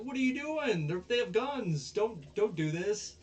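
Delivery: panicked plea. Retold or imitated. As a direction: plead in a panic, voice shooting up. Onizuka panics about the guns.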